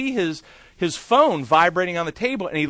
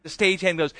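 ordinary talk, nothing marked

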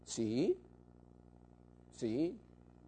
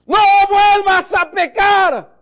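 much higher, louder, slow shouted command; stern shout; 16 dB louder, slower than usual. Retold or imitated. Father firmly warning his son, shouting. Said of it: "Le miró a los ojos y le dijo".

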